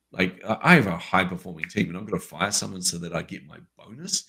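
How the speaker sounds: ordinary delivery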